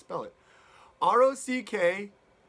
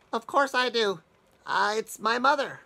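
a higher, louder little creature voice, matter-of-fact; little toad; 4 semitones higher, 5 dB louder than usual. Acted. Little toad answering the wolf, higher and sure. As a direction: chirp it back proudly.

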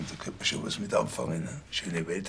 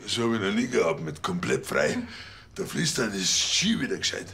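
words shaken by fists pounding his back; voice jolted by drumming; 4 dB louder than usual. Acted. Officer being pummeled during treatment, voice jolted. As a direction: talk while being thumped on the back.

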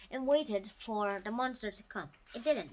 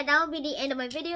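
ordinary delivery

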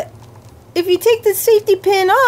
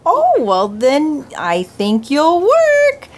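low, slow puppet voice; deep puppet voice; 8 semitones lower, slower than usual. Pretend. Puppet accepting the pretend doctor, low and slow. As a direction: drawl it out low like the big puppet.

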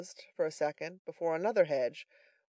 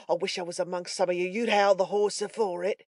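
growled curse, rising louder at the end; ferocious growl; 3 semitones higher, 4 dB louder than usual. A narrator's reading. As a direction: growl it bitterly at the pursuers. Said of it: "growled forth this imprecation with the most desperate ferocity".

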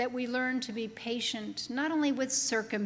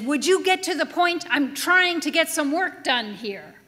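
loud, higher impatient bark; 4 semitones higher, 8 dB louder than usual. Retold or imitated. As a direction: snap at her to hurry up. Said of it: "he barks again and says".

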